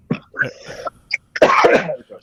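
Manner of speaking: hard real coughing fit. Real, not acted. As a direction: cough hard, choking on water. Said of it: "I love that you cough".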